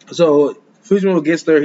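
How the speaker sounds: ordinary delivery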